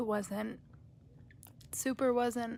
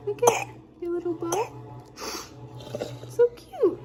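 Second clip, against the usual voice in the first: cooing; 7 semitones higher, 3 dB louder than usual. Real, not acted. Cooing over child's bow.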